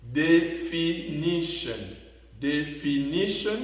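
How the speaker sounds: DEFINITION slowly, stress on "ni"; next-to-last syllable stressed. Shown as a demonstration. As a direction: sound out "definition" syllable by syllable, accenting "ni". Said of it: "l'accent sur l'avant-dernière syllabe".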